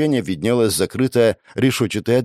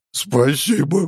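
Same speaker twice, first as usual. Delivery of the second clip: tearful thanks; 6 semitones higher, slower than usual. Acted. Cow boss sniffling thanks.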